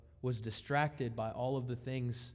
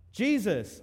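complaining call; 6 semitones higher, 6 dB louder than usual. Retold or imitated. Martha complains to Jesus.

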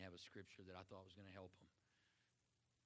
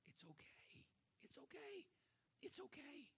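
gentle reassurance; 8 dB quieter than usual. Pretend. Soothing whispered reassurance.

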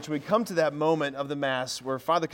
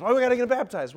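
mock parent arguing; 7 semitones higher, 5 dB louder, faster than usual. Parent objecting in acted exchange.

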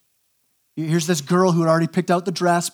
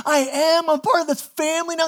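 high, overwhelmed outburst; imitated blown-away kid; 8 semitones higher than usual. Foster kid hugged by his new mom, imitated overwhelmed amazement. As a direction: imitate a kid who can hardly believe it. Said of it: "he's blown away".

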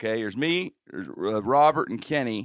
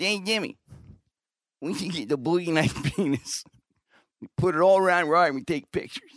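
lightly voiced Kenny, higher; Kenny's voice; 5 semitones higher than usual. Buddy Kenny calling him over, lightly voiced impression. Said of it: "That's how Kenny talks".

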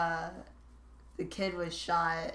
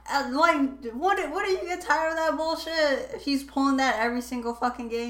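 exasperated; 7 semitones higher, 4 dB louder than usual. Retold or imitated. Voicing exasperation at repeated thief.

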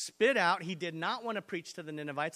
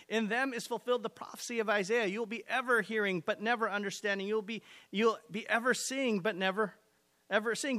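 reading the passage out loud and driving it home; forceful scripture reading; 2 semitones higher, 4 dB louder than usual. Acted. Pastor reading Matthew to the church, preaching loud. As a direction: preach the verses with rising force. Reads the Bible passage aloud.

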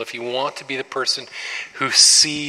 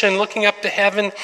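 normal talking voice

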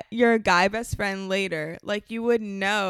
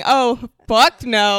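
loud, drawn-out refusal; emphatic shout, each word stretched; outraged refusal; 8 dB louder, much slower than usual. Acted. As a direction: refuse it slowly and loudly. Repeating her screamed refusal.

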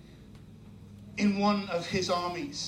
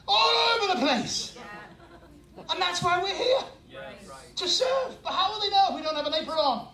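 full-force preaching; 6 semitones higher than usual. Acted. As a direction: drive it home like a revival preacher.

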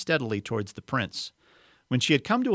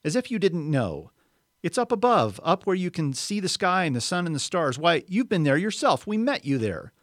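higher, louder, exasperated; indignant boy; 7 semitones higher, 3 dB louder than usual. Acted. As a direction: insist it's real, exasperated. Boy arguing with witch.

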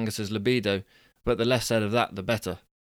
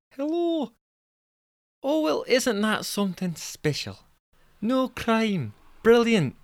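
very high Scottish woman's voice; Jessica; 12 semitones higher, slower than usual. Acted. Jessica replying to Angus, high Scottish accent. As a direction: squeak it out in a put-on Scottish accent. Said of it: "We've decided she's Scottish".

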